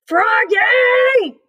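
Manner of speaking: high, loud shout of a name; shouted call. A narrator's reading. Coach shouting to Froggy on the field. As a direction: yell across the field. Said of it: "called the coach".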